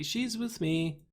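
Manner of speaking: slow, dry deadpan. Retold or imitated. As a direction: say it dead flat and casual. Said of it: "She just deadpans casually".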